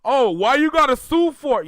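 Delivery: high, loud whiny fan voice; loud high mocking whine. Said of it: "here come the fans now".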